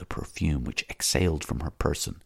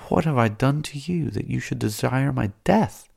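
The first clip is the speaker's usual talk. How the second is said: a lightly pleading, mildly sorrowful question; plaintive; 7 semitones higher than usual. Acted. Salambo pleading with Matho, mildly plaintive. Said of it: "in a plaintive voice".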